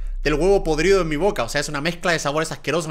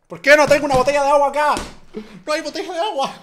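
high, pleading wail for water; desperate high whine; 10 semitones higher, 3 dB louder than usual. Real, not acted. Vlogger with rotten fish taste wails about having no water, high desperate whine.

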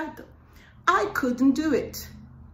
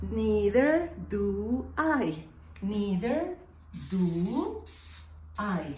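Neither do I drawn out very slowly; slow, careful classroom model; slow teaching demo; much slower than usual. Shown as a demonstration. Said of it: "la otra manera de decirlo".

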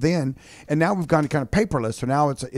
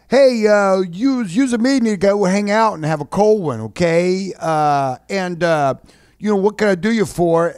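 loud, higher, hesitant phone pitch; mock sales call; 6 semitones higher, 6 dB louder than usual. Trainee picking up the ringing phone, loud fumbling sales greeting. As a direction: blurt out a bungled sales pitch, loud and high.

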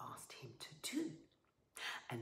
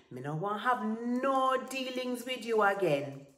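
high, loud refusal; indignant dismissal; 6 semitones higher, 8 dB louder than usual. Acted. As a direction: dismiss him firmly, voice raised. Serena indignantly dismisses Tiger.

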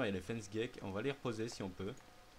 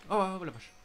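startled; 7 semitones higher, 8 dB louder than usual. Real, not acted. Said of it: "Il m'a fait peur".

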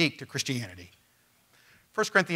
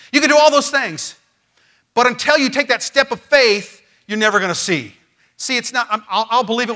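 raised, louder pulpit voice building to a peak; forceful preaching; 6 semitones higher, 8 dB louder than usual. Acted. Pastor urging the congregation to faith, forceful preaching. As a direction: preach it hard to the room.

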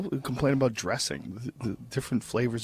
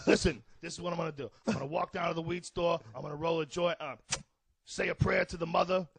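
higher-pitched, indignant foul-mouthed comic; Joey Diaz; 6 semitones higher than usual. Acted. Impersonating the friend refusing to swim, indignant. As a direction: play an affronted, ranting loudmouth. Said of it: "Diaz complains about shit".

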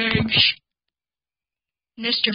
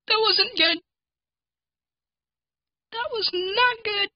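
high-pitched alarm, second take slower; 11 semitones higher, slower than usual. Shown as a demonstration. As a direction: say it high and scared, then slower.

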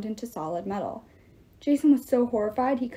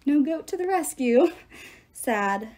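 chuckling; 3 semitones higher than usual. A narrator's reading. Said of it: "Midas chuckled".

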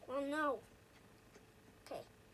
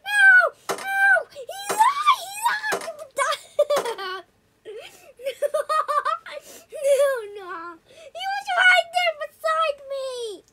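laughing screams; 8 semitones higher, 10 dB louder, much slower than usual. Real, not acted.

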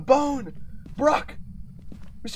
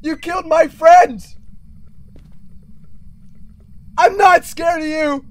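defiant shouting; 6 semitones higher, 6 dB louder than usual. Real, not acted. Yelling defiantly at the ghost.

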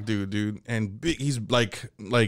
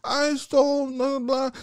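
put-on meek kid; 12 semitones higher than usual. Kid answering.

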